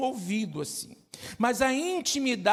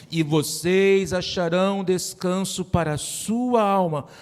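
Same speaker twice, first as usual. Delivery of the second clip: louder, solemn reading of the verse; scripture reading; 3 semitones lower, 3 dB louder than usual. Acted. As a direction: proclaim the verse to the congregation. Reads the Bible verse aloud.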